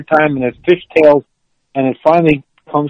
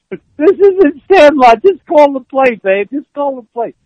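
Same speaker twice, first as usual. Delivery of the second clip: retold yell; 10 semitones higher, slower than usual. Lineman yelling at quarterback in the huddle. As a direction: bark it at the quarterback. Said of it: "he yells".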